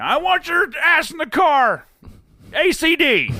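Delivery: high, slow bossy parent yell. Retold or imitated. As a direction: bark orders at the kids. Parent ordering kids into car.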